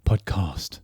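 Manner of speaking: "Podcast" with a long broad a, "pod-cahst"; character's pronunciation. Pretend. Host correcting the word for the character, "Podcast" said pod-cahst. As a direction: say "Podcast" low, stretching the second vowel to "cahst". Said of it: "what this character would pronounce podcast".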